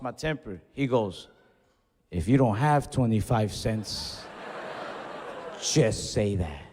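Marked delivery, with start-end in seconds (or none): 2.1-6.6 low, slow, deliberate put-down; retold condescension, drawn out and low; condescending cashier. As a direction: say it slowly and low, talking down to the customer. Clerk's condescending jab in retold argument.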